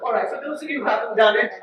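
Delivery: usual speaking voice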